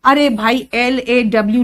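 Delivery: usual speaking voice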